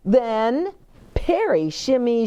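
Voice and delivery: ordinary delivery, nothing marked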